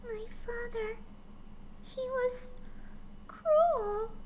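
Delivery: high, soft, trembling little pony voice; frightened daughter remembering her father, high and soft; soft whimper. Acted. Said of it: "she whimpered softly to herself".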